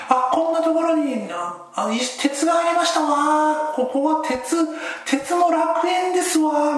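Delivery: high, slow refined lady voice, delighted; Salome. Pretend. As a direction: play a prim rich girl finding treasure. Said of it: "俺の脳内でサロメ様が喋りながら".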